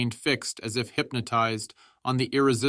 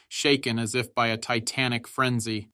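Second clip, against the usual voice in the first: plain reading voice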